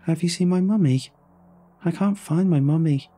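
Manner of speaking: put-on high child's voice, saying it again and again; a little girl stuck on one question; small girl repeating. A narrator's reading. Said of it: "the same things over and over".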